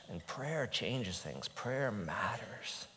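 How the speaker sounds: quiet conviction. Real, not acted.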